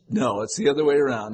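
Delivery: normal talking voice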